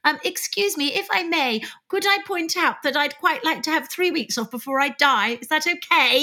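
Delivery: high, apologetic over-polite plea; mock polite Brit. [name] mocking Brits politely asking for time off, high and meek. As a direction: ask permission to riot as timidly as possible. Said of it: "We'd be like".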